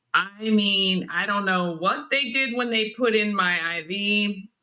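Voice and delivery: usual speaking voice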